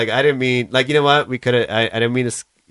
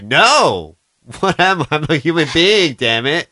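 mock indignant protest; 4 semitones higher, 5 dB louder, slower than usual. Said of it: "Would you be like".

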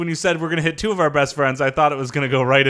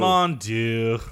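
low, soft mock French sigh; french chef; 7 semitones lower, 4 dB quieter than usual. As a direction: mutter it like a weary French chef. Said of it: "Very good, [name]".

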